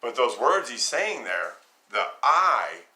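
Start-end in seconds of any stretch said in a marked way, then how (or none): 2.2-2.9 "I" said loud and firm; a forceful stress on "I"; emphatic "I". Shown as a demonstration. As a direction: stamp the word "I". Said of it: "is absolutely emphatic".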